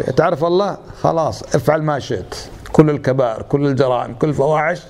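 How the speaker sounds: usual speaking voice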